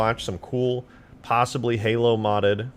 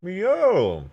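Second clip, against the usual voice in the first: surprised; 10 semitones higher, 5 dB louder than usual. Real, not acted. Sees mod armor in video.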